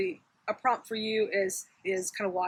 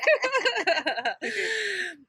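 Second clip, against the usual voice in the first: high laugh, fading off; trailing laugh; 11 semitones higher than usual. Real, not acted. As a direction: let the laugh die away. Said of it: "I have gum in my mouth".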